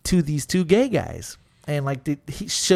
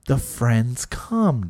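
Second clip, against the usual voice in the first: normal talking voice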